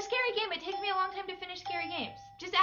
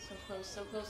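ordinary talk

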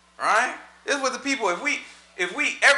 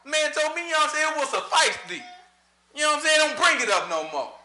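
fired-up preaching; 7 semitones higher than usual. Real, not acted. Teacher retelling how mad Moses was, fired up. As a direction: get worked up telling it.

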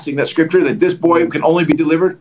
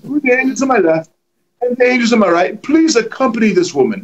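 raised posh British accent; 5 semitones higher than usual. Shown as a demonstration. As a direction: put on a British accent for fun. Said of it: "I did with a British accent just for fun".

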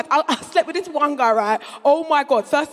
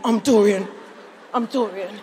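broody Dorian; 6 semitones lower than usual. Retold or imitated. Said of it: "he was, like, broody".

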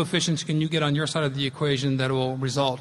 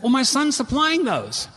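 proud mother's reply; 10 semitones higher, 5 dB louder than usual. Retold or imitated. Mother quoted answering the doctor.

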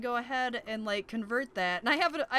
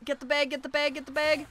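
ordinary talk

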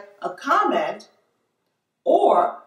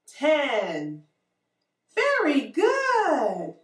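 put-on reading to a toddler; 4 semitones higher, slower than usual. Teacher reading to small children.

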